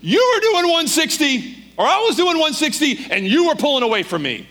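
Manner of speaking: imitated cop's yell, high and loud, easing at the end; outraged accusation at full voice. Patrolman telling driver how fast he went, yelling. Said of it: "He yells at the top of his lungs".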